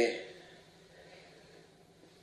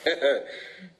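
mocking laugh; 5 semitones higher than usual. Hanged man mocks the scientists.

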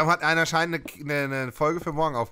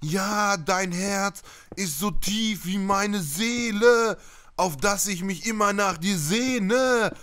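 high, slow mock-romantic recital; schmaltzy love poet; 7 semitones higher, much slower than usual. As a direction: recite it slowly like a lovestruck amateur poet. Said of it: "Weißt du, manche Leute dann".

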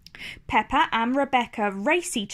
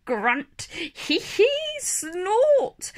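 high squealing giggle and snorts; happy piglet noises; 7 semitones higher, much slower than usual. Acted. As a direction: squeal with glee like a tiny piglet. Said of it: "shouts George happily".

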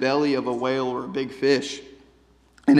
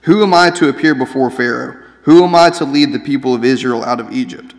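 loud protest; 9 dB louder than usual. Acted. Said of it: "Moses protested to God".

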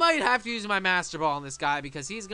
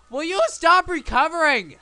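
high, raised-voice protest; exasperated complaint; 7 semitones higher, 7 dB louder than usual. Real, not acted. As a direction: scold the opponent in exasperation. Exasperated at the healing opponent.

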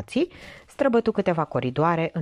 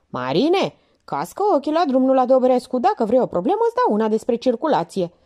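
higher, louder sharp rebuke; Minerva snapping; 6 semitones higher, 4 dB louder than usual. Acted. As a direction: scold the driver to watch the road. Minerva scolds driver.

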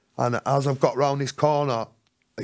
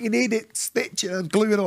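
higher insistent nurse voice; 8 semitones higher than usual. Retold or imitated. Hospital nurse telling the addict to get stitches, higher insistent. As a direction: urge him like a stern nurse. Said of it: "She went".

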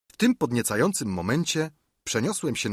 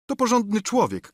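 much higher doctor's remark; approving comment; 11 semitones higher than usual. A narrator's reading. (wyższy głos doktora)

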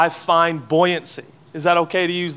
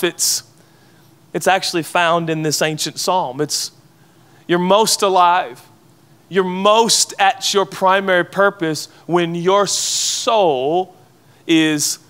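normal talking voice

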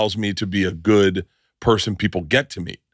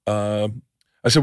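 ordinary delivery

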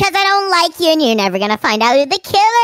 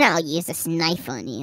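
much lower and softer, menacing; low quiet threat; 12 semitones lower, 10 dB quieter than usual. Acted. TV woman threatening the builder with a knife, low and quiet. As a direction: drop it low and threaten him.

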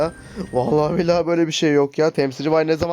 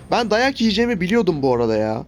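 normal talking voice

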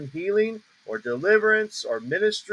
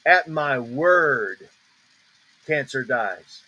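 "word" drawn out, then "cancer dies" drops low; stress on WORD; 5 semitones lower, much slower than usual. Shown as a demonstration. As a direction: stretch "word" firmly, pause, then drop. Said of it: "You hear the emphasis?".

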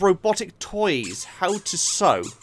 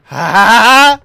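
loud startled cry; 5 semitones higher, 13 dB louder than usual. Real, not acted. Player waiting for a scare to pop up, loud cry. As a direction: cry out loud as something pops up.

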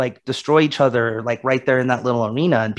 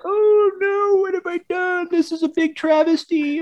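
much higher mock-dramatic wail of a guilty hero; mocking Achilles's guilt in a hammed-up, much higher wail; mock anguished hero; 3 dB quieter than usual. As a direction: ham up the remorseful hero, way up high. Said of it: "He's like".

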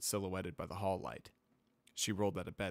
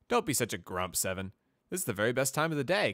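cheerful teasing; 5 semitones higher, 6 dB louder, faster than usual. Acted.

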